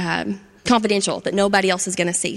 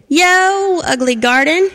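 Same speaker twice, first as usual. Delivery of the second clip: much higher, louder mocking call; taunting yell; 8 semitones higher, 5 dB louder, slower than usual. Frappy yelling insults at Charlie's garden, loud taunt. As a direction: jeer across the fence. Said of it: "he yelled".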